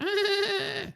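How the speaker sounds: high squeal, imitating crying; a shrill mock sob; mock wail. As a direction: squeal it like a whiny cry. I hate her crying.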